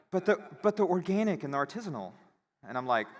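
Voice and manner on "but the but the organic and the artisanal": theatrical gasp then a much higher, fast, laughing whine; mock shocked clerk. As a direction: lampoon her horror over organic food. Mocking the clerk's shocked reply.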